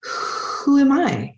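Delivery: self-doubting quote. Retold or imitated. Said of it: "And I'm like".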